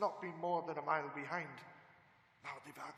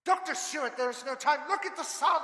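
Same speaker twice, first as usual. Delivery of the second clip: high, loud desperate call; urgent shout; 11 semitones higher, 12 dB louder than usual. Acted. Van Helsing shouting at Seward as the sun sets.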